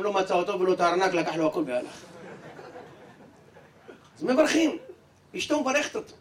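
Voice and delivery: ordinary delivery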